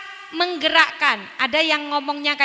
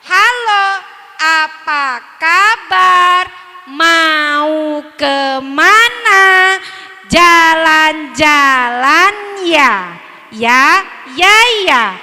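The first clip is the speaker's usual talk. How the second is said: slow puppet greeting; 11 dB louder, much slower than usual. Shown as a demonstration. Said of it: "Harusnya itu kalau halo".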